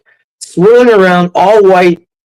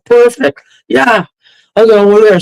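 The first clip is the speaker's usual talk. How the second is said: higher-pitched pleased discovery; retold eagerness; 4 semitones higher than usual. Spotting a garbage can in the park, retold eagerness. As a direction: say it as a sudden find, pitched up. Retelling his relief at the find.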